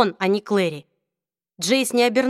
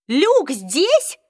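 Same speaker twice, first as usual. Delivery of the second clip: higher, louder alarmed question; frightened question; 7 semitones higher, 4 dB louder than usual. A narrator's reading. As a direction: gasp it out in alarm. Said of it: "испуганно спросила Изабель".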